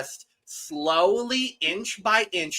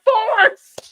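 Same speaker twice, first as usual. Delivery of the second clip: high, loud, drawn-out announcement; triumphant exclamation; 10 dB louder than usual. Real, not acted. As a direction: shout the good result in delight. Cheering the team's surprise placing.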